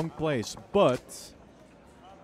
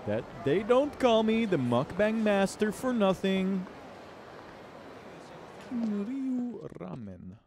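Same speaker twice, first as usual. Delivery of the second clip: hyped YouTuber; 6 semitones higher than usual. Pretend. Continues mukbang YouTuber bit.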